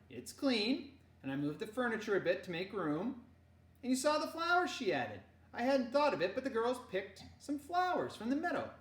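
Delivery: high, loud motherly request; mother's voice. Acted. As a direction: ask sweetly but firmly. Mama voiced.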